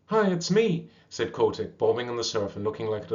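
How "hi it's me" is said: much higher, louder little call; bright high call. Acted. Young seal calling up to sleepy walruses, high. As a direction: pipe up to be heard.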